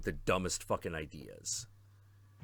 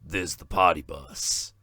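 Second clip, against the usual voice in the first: party dude voice; 3 semitones lower, 7 dB louder than usual. Retold or imitated. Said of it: "he's like".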